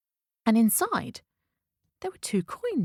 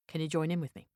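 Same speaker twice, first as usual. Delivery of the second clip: ordinary delivery, nothing marked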